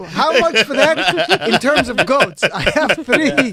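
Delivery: mock Arabic accent, slow and loud; hammed-up Arabic merchant bargaining. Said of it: "And I use my Arabic accent".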